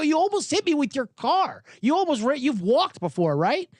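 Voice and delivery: high, exasperated mock protest; acted scolding. Golfer tells off the driver who nearly hit him, mock exasperation. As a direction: argue it as if nearly run down.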